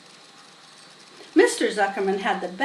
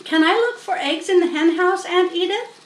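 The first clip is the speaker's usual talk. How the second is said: put-on pleading girl; 7 semitones higher, 4 dB louder than usual. A narrator's reading. Fern voiced asking.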